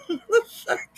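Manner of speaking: laughing. Real, not acted. Cracking up at clip.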